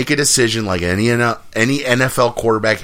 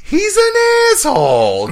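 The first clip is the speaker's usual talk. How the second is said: much higher, slow, flat-out blunt verdict; imitating Marty finally saying it; deadpan Marty; 5 dB louder than usual. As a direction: let it drop slow like the line you waited three movies for. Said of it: "he just goes".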